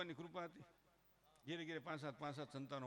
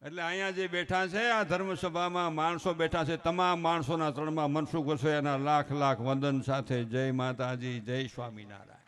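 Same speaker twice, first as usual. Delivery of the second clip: formal salutation; 2 semitones lower, 13 dB louder than usual. Acted. Saluting everyone seated in the religious assembly, loud formal address. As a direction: proclaim it to the whole hall.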